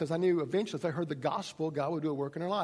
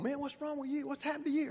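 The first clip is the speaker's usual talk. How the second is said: mock coworkers; 10 semitones higher, 3 dB quieter than usual. Coworkers question Jim.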